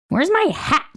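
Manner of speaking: put-on high, sharp little-girl snap; grumpy child spitting a demand. A narrator's reading. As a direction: spit it out like a grouchy goblin. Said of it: "she spits".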